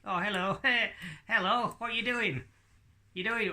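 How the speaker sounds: indignant little puppet being woken; puppet Hadley, startled. Acted. Puppet Hadley greets audience.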